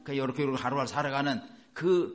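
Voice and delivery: ordinary talk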